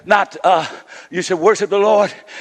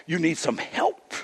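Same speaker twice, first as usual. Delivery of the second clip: ordinary talk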